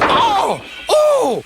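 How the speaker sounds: very high yelps of "oh"; pained cry. A narrator's reading. Brake van bumped by Donald, crying out. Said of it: "cried the van".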